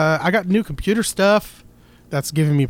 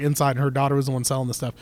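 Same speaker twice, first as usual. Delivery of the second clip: ordinary talk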